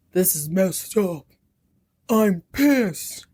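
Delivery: slow imitated male outburst; half-hearted flipping out; mock anger. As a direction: play at being pissed off. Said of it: "He flips out".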